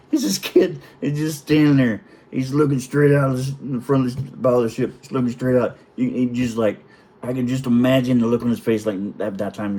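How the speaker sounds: normal talking voice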